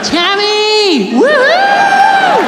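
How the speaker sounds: very high, shrieked party whoop. Retold or imitated. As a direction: squeal it out, loud and long.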